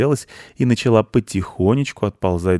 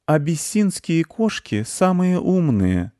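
high, slow, lofty cat voice; condescending; 10 semitones higher, slower than usual. A narrator's reading. Masha the cat condescendingly lectures the ginger tomcat. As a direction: talk down to him with lofty patience. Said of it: "сообщила Маша-кошка снисходительно".

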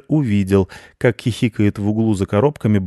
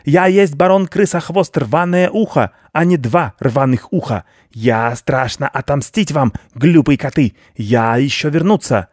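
vengeful rat; 5 semitones higher than usual. Acted. Rat vowing revenge.

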